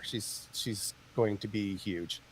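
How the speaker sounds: usual speaking voice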